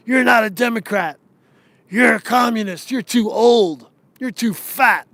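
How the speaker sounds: mocking voice. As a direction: sneer it like a smug insider brushing people off. Mocking the party's dismissive voice.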